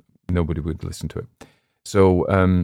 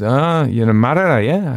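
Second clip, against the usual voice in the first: slow, mock-broad Cumbrian greeting, rising at the end; a Cumbrian local warmly recognising one of his own; Cumbrian dialect; 7 semitones higher, 5 dB louder, slower than usual. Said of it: "he wasn't as broad as this fella".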